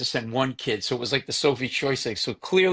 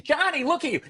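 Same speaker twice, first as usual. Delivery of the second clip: much higher, a mother fussing over her grown brother; scolding mother. Retold or imitated. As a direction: chide him like a big sister fixing his clothes. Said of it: "revert back to being the kid".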